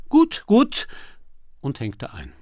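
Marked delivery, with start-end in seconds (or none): 0.1-0.9 high, loud call. A narrator's reading. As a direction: call it down the phone. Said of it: "rief er". (laut und hoch gerufen)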